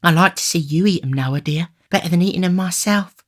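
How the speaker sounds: high, fond feminine voice; high-pitched adoring young woman; doting sweetheart. A narrator's reading. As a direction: coo it fondly, pitched well up. Charlotte dotes on Noah.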